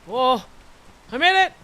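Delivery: sudden high excited yell; excited shout. Real, not acted. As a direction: shout it out in surprise. Sudden surprise in game.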